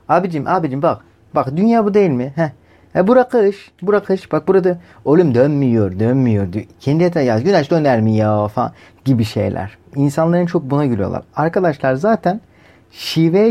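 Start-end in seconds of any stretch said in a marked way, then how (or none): 0.1-8.5 light imitation of a child's regional Turkish accent, pitched up on bura kış; mock regional Turkish accent. Kids arguing over a globe, in a lightly mocked regional Turkish accent. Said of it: "Çocukların bunları biraz şiveli konuşmaları".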